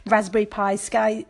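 ordinary talk, nothing marked

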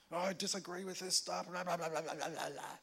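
put-on grumbling voice running into babble; mock complainer. Preacher imitating believers who fight it, mocking complaint. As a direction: mimic a tiresome objector rattling on. Mocks people who argue online.

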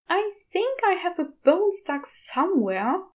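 a touch of self-pity in a plaintive voice; mildly mournful complaint; mournful Aziraphale. A narrator's reading. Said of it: "Aziraphale says mournfully".

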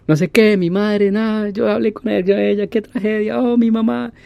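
high, wailing mock lament; imitated bawling. Driver bawling over his mother while driving, imitated high wail. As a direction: bawl it out like a grown man howling for his mother. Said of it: "como berreando".

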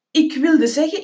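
ordinary delivery, nothing marked

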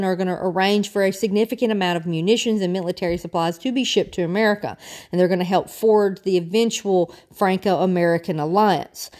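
ordinary delivery

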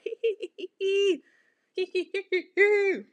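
high mocking hee-hee giggle. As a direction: giggle like a gossipy boy. Mock giggling gossip guy.

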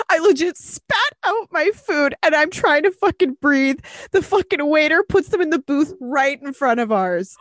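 high, worked-up disbelief; scandalised retelling. Retold or imitated. Host reading a listener's cheating-date discovery, scandalised.

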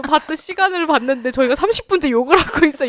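loud laughing protest. Real, not acted. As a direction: laugh it off loudly. Laughing about complaining so long. (크게 웃으며 항변하기)

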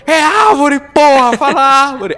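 furious yelling at a mispronunciation; angry shout. Retold or imitated. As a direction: yell it out, fed up. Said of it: "o cara já tava puto".

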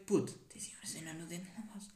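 secretive whisper, conspiratorial; suspicious whisper. Retold or imitated. Leaning to Duarte about his tooth, whispering. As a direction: hiss it like a secret. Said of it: "bué suspeito".

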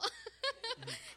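high, loud giggle. Real, not acted. Bride recalling her wedding night, giggling. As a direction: burst into a high laugh mid-testimony.

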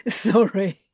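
hoarse, through laughter; raspy laughing. Acted. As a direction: rasp it out helplessly. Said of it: "Crowley rasped helplessly".

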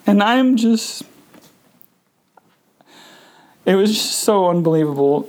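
tearful, voice cracking upward; fighting back tears as it hits him; real grief breaking through. Real, not acted. Grief while recounting mother's death.

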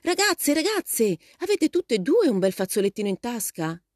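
high-pitched call from the window. A narrator's reading. Mother calling from the window to departing girls, shouting. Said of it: "una voce gridò alla finestra".

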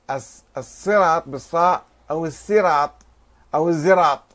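الصراط with emphatic s, then الزراط with z; sad, sin, zay variants. Shown as a demonstration. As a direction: demonstrate each consonant in الصراط and الزراط. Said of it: "في السين والصاد والزاي والإشمام". (الصراط بالصاد والسين والزاي)

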